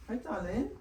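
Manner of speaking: high, soft hello to a pet; little high-pitched greeting for an animal; cooing pet greeting. Real, not acted. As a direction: coo sweetly, pitched well up.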